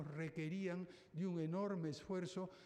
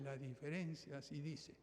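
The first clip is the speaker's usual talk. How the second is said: usual speaking voice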